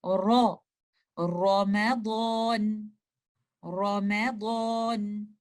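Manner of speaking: रमज़ान drawn out very slowly with a full, heavy रॉ; slow careful recitation of रमज़ान with heavy रॉ; heavy Quranic ra. Shown as a demonstration. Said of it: "इसको हम रमज़ान नहीं पढ़ेंगे".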